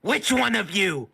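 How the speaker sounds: high, loud, drawn-out demand; alarmed rat creature. Acted. Rat demanding answers from its captors, high and alarmed.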